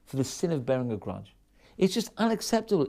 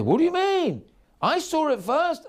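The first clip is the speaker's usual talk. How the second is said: indignant retort; 11 semitones higher, 3 dB louder than usual. Retold or imitated. Rich woman answers back.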